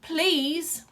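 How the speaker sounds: high, rising plea; begging. Acted. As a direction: beg desperately.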